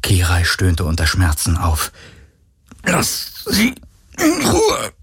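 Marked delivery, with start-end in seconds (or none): 2.8-4.9 much higher, cracking with pain; strained plea. Acted. Galen begs the creature to spare Kirai, strained. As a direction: force it out while in agony. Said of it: "brachte Galen hervor".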